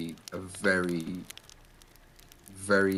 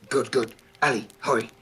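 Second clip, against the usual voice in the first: urgent wizard, higher and loud; Vador; 6 semitones higher, 7 dB louder than usual. Acted. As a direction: hurry them along, pitched up and forceful. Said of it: "he sort of turns around".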